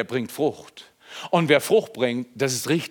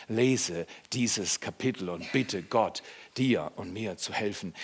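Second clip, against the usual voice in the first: slow, soft and lower invitation; gentle invitation; 6 semitones lower, 7 dB quieter, slower than usual. Real, not acted. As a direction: invite them gently to pray. Invitation to pray. (leise, langsame Einladung)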